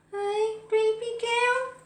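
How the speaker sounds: high, drawn-out cooing; high-pitched pet greeting. Real, not acted. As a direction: coo slowly to a pet in a high voice. Talking to her pet.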